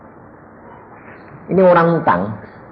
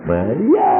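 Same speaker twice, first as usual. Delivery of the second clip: usual speaking voice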